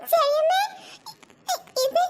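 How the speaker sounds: ordinary delivery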